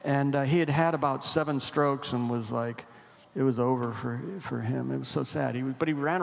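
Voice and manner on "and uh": ordinary delivery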